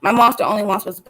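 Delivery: earnest, steady poetry recital; spoken word poem. Acted. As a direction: perform it like slam poetry.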